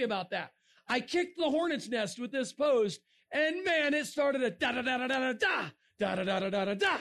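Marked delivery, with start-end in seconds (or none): 4.4-7.0 machine-gun "da-da-da" with a punched last beat; imitated barrage of noise; rapid-fire imitated chatter. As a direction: rattle it off like a flurry of posts. Mimics rapid back-and-forth online posts.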